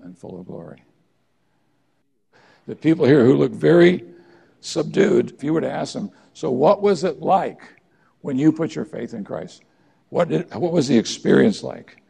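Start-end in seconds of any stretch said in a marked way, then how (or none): 2.7-12.0 rousing appeal. Real, not acted. Preacher rousing the congregation.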